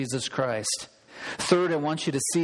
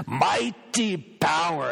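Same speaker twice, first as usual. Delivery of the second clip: put-on slow, heavy Argentine Spanish accent on mighty power; much slower than usual. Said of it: "a very heavy accent".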